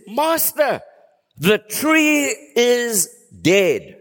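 high, slow, amazed call of the tree's death; astonished Peter. Retold or imitated. Peter telling Jesus the fig tree has died, high and astonished. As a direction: gasp it out as the shocked disciple. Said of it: "the next day Peter says".

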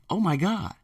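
soft, breathy-free gasp of amazement; imitating a wowed student; student reacting to the ad, amazed. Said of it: "someone else is gonna say".

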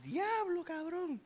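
high, hushed disbelief; stunned high-pitched awe; awed gasp. Real, not acted. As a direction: breathe it out, floored by the verse. Stunned reaction to the song's line.

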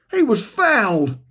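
pouting. Acted. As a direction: sulk like a child denied. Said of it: "Danny pouts".

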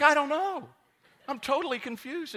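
very high, very loud comic wail; mock despairing wail. Pastor retelling his total confusion, high comic wail. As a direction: throw up your hands in defeat. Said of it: "I was like".

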